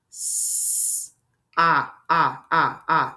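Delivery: long hissed "S", then "ah" repeated as separate short vowels; tapping out sounds. Shown as a demonstration. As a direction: hold "S" alone, then punch out each "ah" separately. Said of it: "we can tap out words".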